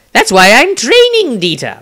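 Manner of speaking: high, loud defensive protest. Acted. Wizard defends himself to Dieter, high and indignant. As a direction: push back, stung at the criticism. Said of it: "Maestro replied defensively".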